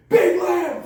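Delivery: high, loud imitated bellow; coach's yell. Coach sending linemen round again, mock yell. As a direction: yell it like a coach ordering laps. Coach orders big lap, retold.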